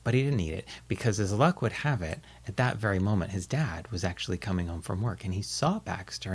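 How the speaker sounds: usual speaking voice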